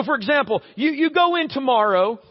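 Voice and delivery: usual speaking voice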